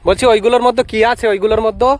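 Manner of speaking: to someone hard of hearing. Real, not acted. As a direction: call out again so he hears. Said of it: "মানে কম শোনে আর কি".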